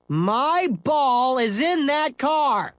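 put-on slow, high accusing boy voice. Scooter blames Henry, slow high accusation. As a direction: lay the blame on him. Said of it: "he said accusingly".

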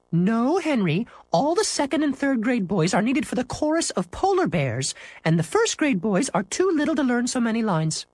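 lightly voiced high-pitched teacher; teacher's refusal. Teacher explaining to Henry why not, high teacher voice.

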